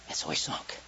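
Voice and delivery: low, hushed whisper; whispered narration. A narrator's reading. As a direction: drop the voice as he sinks.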